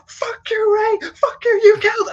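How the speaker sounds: very high, loud, strained mock rant; losing it. Villain raging at Ray on the phone, losing it. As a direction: imitate an enraged man cracking into a shriek. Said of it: "he just, like, totally loses it".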